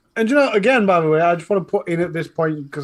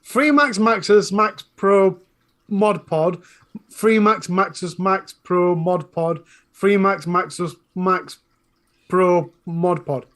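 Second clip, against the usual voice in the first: slow tongue twister; slower than usual. Real, not acted. Repeats tongue twister carefully.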